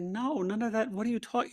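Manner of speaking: high, incredulous protest; baffled wife. Retold or imitated. Wife baffled by his hospital visions, high and incredulous. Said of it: "She was like".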